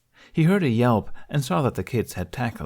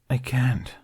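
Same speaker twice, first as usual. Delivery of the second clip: sad; 4 semitones higher than usual. A narrator's reading. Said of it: "He sounded so sad".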